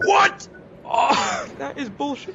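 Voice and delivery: high-pitched outraged squeal; shocked squeal. Real, not acted. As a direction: shriek in disbelief, then laugh. Killed suddenly, outraged.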